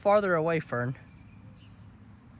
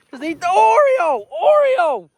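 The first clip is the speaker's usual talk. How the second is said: pleading; 6 semitones higher, 7 dB louder than usual. Real, not acted. Pleading with calf.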